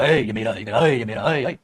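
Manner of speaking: low mock claimant's voice; squabbling noun. Noun claiming its owner word as its own, low mock squabbling. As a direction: drop low and repeat the claim insistently.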